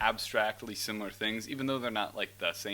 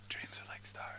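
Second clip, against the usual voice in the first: whisper; 12 dB quieter than usual. Pretend. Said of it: "I'm like, Bro".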